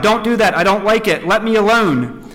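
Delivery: acted yell, pushing someone off; shouted protest. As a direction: cry out in protest, reading aloud. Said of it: "I yelled and shouted out".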